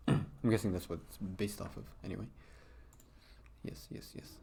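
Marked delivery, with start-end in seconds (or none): 3.6-4.3 breathy hushed repeats; soft whispered agreement; whispered yes. Acted. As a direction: whisper it under your breath. Reading a character's quiet line.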